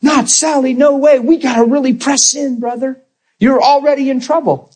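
preacher mocking panicked prayer; frantic shout. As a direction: plead as if you could force it. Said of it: "It's not about".

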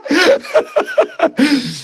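hearty laugh up high; big high laugh. Real, not acted. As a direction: let a big laugh burst out. Laugh after joke.

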